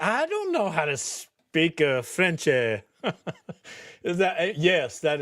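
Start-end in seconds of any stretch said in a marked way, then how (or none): 0.0-2.8 hammed-up French accent with extra vowels after words; mock French accent. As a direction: play at a bad French accent. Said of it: "Say it with a French accent".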